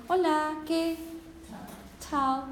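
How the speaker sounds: imitated foreigner's Spanish. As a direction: mimic a foreigner's aspirated Spanish. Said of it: "when we try to imitate".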